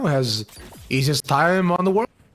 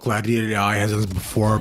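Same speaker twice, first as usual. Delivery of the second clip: ordinary delivery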